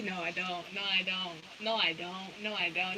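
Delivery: low, soft self-argument; quiet, acted backpedalling; muttered denial. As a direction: repeat it under your breath, convincing yourself. Acts out their self-argument.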